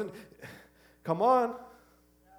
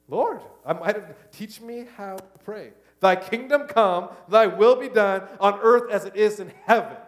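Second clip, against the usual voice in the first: slow prayer; 3 semitones higher, 8 dB louder than usual. Acted. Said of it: "repent and say".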